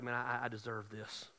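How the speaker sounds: low, soft mock self-reproach; frustrated with himself. Peter blames himself for his denials, low and frustrated. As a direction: own up quietly, stumbling on the I. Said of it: "he was frustrated with himself".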